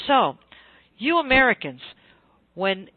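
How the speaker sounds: single them out, high and loud with pauses; British speaker addressing Americans. Retold or imitated. Oxford author singles out the American in the crowd, mock challenge. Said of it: "and said to me".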